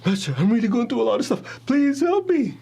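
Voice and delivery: high, whiny mock begging; pleading whine. Student begging his calm teacher in a high whine. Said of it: "I begged".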